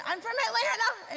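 high-pitched holler back; calling back. Retold or imitated. Newcomer answering the neighbor across the street, high mock holler.

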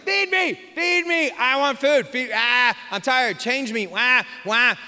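put-on high, whining baby demands; whiny baby voice. Pastor voicing a fussy baby wanting food, high and whiny. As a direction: whine like a hungry, tired infant. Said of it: "When they're hungry, they're like".

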